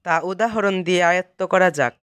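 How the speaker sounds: ordinary talk, nothing marked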